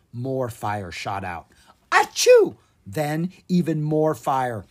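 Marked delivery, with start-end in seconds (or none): 1.9-2.6 loud sneeze. Acted. Dragon sneezes again.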